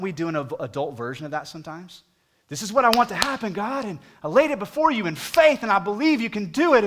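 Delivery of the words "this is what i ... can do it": much higher and louder, insistent plea to God; demanding prayer. Retold or imitated. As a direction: pray it like you're making demands.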